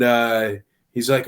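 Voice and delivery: ordinary talk, nothing marked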